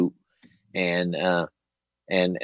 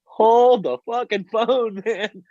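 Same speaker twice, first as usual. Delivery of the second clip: put-on much higher, amazed child's voice; excited kid; 14 semitones higher than usual. Boy hearing his uncle's song on the radio, excited. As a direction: burst out like a thrilled boy. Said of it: "and I was like".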